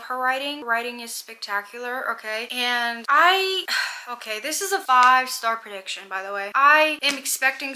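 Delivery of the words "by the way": ordinary talk, nothing marked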